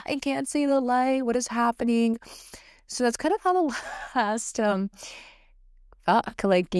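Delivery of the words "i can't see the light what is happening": pitched up, bewildered and fed up; exasperated cry. Retold or imitated. Venting to listeners about endless hard times, exasperated cry. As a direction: cry out, lost at the tunnel's end. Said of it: "And you're just like".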